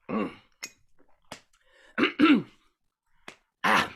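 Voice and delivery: ordinary talk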